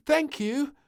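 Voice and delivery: high, loud, giggly thank you; giggling thanks. Acted. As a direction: giggle it out happily. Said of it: "giggled Little Princess".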